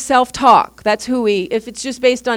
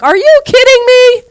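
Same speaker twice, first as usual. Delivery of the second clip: much higher, loud disbelieving cry; incredulous; 14 semitones higher, 10 dB louder than usual. Retold or imitated. Telling a friend their loss is serious, in loud disbelief. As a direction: exclaim it in total disbelief. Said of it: "I was like".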